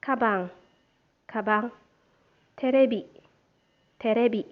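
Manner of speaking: each word said twice, clearly and evenly, for the class to repeat; careful teacher drill; pronunciation drill. Shown as a demonstration. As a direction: model every word carefully so students can copy it. Teacher models vocabulary for repeating.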